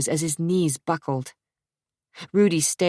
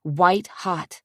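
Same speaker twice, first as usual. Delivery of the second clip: ordinary delivery, nothing marked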